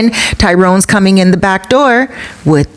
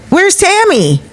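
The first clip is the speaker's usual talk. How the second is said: sudden high-pitched question; comic high retold outburst; out of shock; 13 semitones higher, slower than usual. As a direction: mimic a man abruptly snapping to. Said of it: "finally he's out of shock".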